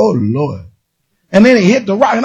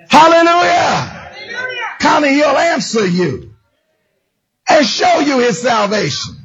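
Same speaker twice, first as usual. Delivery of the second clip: preaching at full force; 3 semitones higher than usual. Acted. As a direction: lift the room with each name. Preaching to the congregation.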